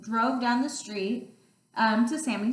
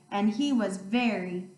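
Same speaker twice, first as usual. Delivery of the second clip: usual speaking voice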